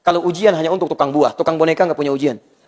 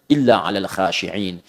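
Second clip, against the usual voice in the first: Quranic recitation; 8 semitones lower, slower than usual. Shown as a demonstration. Quoting verse in recitation.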